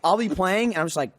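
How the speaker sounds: ordinary talk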